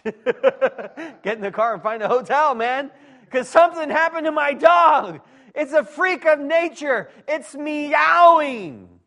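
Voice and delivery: high, loud comic outcry; mock-alarmed shouting. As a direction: holler it, stretching the last word out. Comic alarm at a meowing dog.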